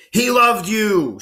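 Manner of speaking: high, slow, loud accusing line; a reader acting out an intense accusation. Shown as a demonstration. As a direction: point the finger and let it ring out. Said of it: "the character is screaming or being really intense".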